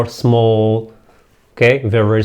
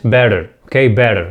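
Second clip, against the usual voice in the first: American accent; 2 semitones lower, faster than usual. Shown as a demonstration. English teacher models it for learners in American accent. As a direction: say "better" the American way, like bedder. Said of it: "وفي الأمريكان accent".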